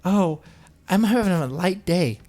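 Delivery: high mincing voice mocking the poster; lilting mock of a social media post. Mocking Yaniv's post in a high voice. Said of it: "questions like".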